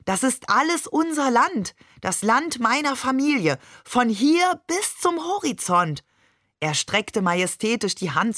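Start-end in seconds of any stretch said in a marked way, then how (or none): 0.0-6.0 much higher, slowing on the last words, grand; proud enthusiasm. Acted. Father showing his family's land to his wife, proud and grand. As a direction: sweep the land with swelling pride. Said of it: "seine Augen leuchteten".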